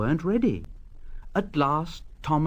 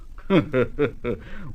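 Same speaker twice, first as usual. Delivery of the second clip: smug chuckle. Acted. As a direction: chuckle as you pass. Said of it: "Gordon chuckled as he passed".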